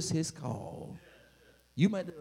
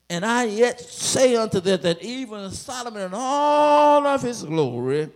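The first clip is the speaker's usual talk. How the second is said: full-force preaching; 4 semitones higher, 9 dB louder than usual. Acted. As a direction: proclaim the verse to the whole church.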